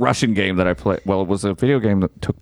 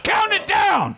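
shouting; slower than usual. Real, not acted. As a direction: call out to start the show. Shouted countdown call.

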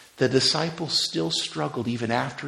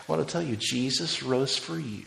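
usual speaking voice

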